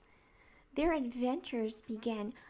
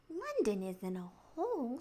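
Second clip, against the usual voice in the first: indignantly. Acted.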